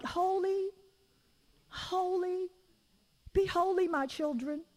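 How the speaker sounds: high, airy singsong holy; mock floaty God. Preacher mocking a floating God, high and airy. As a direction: float the words up like a dreamy voice. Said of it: "God is just like".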